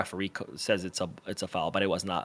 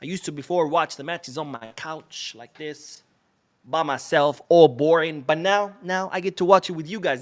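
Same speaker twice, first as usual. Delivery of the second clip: ordinary delivery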